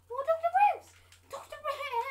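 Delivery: high, very loud wail of the name; exasperated shout. Pretend. Learning the lab is lost, high exasperated shout. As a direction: cry out the name in mock outrage. Pretend outrage at Doctor Bruce.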